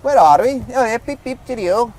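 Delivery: hammed-up English accent, clowning for the camera; mock English accent. Reviewer clowns in a mock English accent. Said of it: "Let's find that English accent again".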